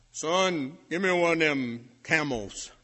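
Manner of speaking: loud swaggering order; bullying demand. Retold or imitated. Big football star ordering the freshman boy, loud bully. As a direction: throw your weight around, loud.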